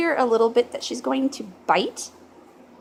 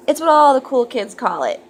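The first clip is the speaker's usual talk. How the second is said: ordinary delivery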